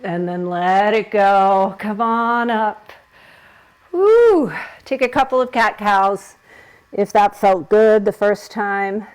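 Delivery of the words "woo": high, loud whoop of effort; bright loud release after the pose; excited whoop. Real, not acted. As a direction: let out a real joyful woo. Exhale after hard back bend.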